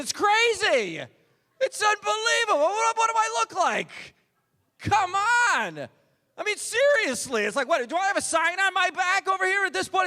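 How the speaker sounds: high-pitched, incredulous comic rant; exasperated rant. Acted. Comic ranting to the crowd about his situation, exasperated.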